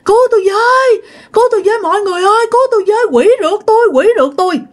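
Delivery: high-pitched, frantic yelling; panicked cry for help. Retold or imitated. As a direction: cry out for rescue as if chased by a ghost. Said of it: "gào lên kêu rằng".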